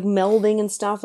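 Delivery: usual speaking voice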